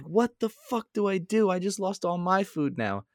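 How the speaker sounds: high, incredulous cry of exasperation; exasperated disbelief. Retold or imitated. Stunned by his ruined dinner, a high exasperated cry. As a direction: throw your voice up in stunned frustration. Just dropped all his food.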